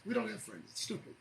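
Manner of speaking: soft, quick drop after an outburst; quiet aside. Real, not acted. Host repeating the point to listeners, hushed. Same words dropped right down.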